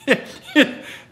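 high, breathless burst of laughter; real high-pitched giggling fit; helpless laugh. Real, not acted. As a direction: crack up mid-story. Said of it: "without laughing".

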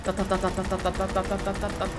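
rapid-fire "ta ta ta" noises; playful, steady string of gun noises; imitated gunfire. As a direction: rattle off machine-gun sounds with your mouth. Imitates gunfire while shooting.

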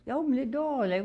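high, eager, open the door; high eager urging; high pleading. Retold or imitated. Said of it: "I'm like".

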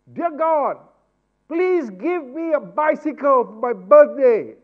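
put-on boy yelling his prayer to heaven; shouting prayer. Boy shouting his birthday wish to God, loud. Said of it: "There's no need to shout".

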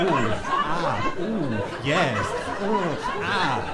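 low, drawn-out comic moans; hammed-up massage moans; mock pleasure moans. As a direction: moan like getting a massage. Leaf moaning from massage.

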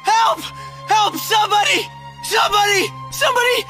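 loud, high repeated shouts; desperate calls for help. Acted. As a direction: yell for anyone to come. Calling for help.

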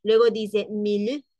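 usual speaking voice

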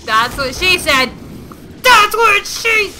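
escalating high-pitched crowing; shouted joke. Real, not acted. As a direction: yell the innuendo, drawing it out. Shouted joke reply mid-game.

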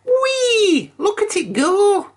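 very high, gleeful squeal of delight; Pig's squeal. A narrator's reading. Pig squealing with joy at the kite. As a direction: shriek it like a thrilled little pig.

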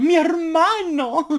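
high, slow, drawn-out proclamation; proud sister. Retold or imitated. Sister presenting her brother on set, high and slow. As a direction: announce your brother grandly. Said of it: "sale así en plan".

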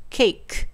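ケーク said as "cake" with a final k sound; English-style ケーク, consonant at the end; English cake pronunciation. Shown as a demonstration. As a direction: finish ケーク on k, no "ki". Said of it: "Kで終わりなんですね".

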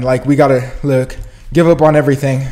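usual speaking voice

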